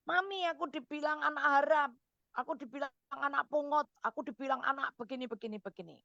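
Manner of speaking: put-on a touch of tears in a child voice; little girl running to her mother upset; imitating crying child. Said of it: "Anaknya kan nangis".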